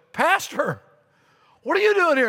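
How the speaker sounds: a startled homeowner at the door; surprised question. Retold or imitated. Couple finds their pastor on the porch at ten, surprised.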